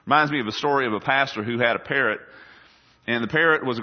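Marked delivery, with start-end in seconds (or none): none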